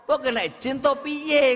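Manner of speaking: tirukan suami bingung, nada jauh lebih tinggi. Retold or imitated. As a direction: heran setengah bercanda, suara naik. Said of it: "Suami yo bingung". (suami bingung melihat istrinya menangis terus)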